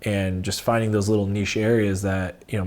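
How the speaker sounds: ordinary talk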